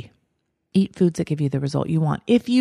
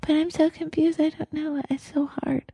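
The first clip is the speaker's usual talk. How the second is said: much higher and softer, fading to a small whine; mock the listener pretending to be helpless; high, quiet, self-pitying whimper; whiny confused voice; 9 semitones higher, 6 dB quieter than usual. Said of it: "You all have that voice".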